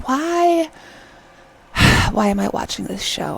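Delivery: annoyed wail deflating into a sigh; exasperated. Retold or imitated. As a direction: lament your own viewing choice. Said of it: "it just really annoyed me".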